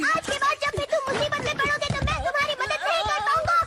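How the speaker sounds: fast, high-pitched ultimatum; angry warning. Acted. Robot friend refuses the boy further help, angry. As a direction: lay down the law in a rush. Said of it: "इतना गुस्से में क्यों हो".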